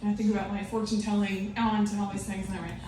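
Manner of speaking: ordinary delivery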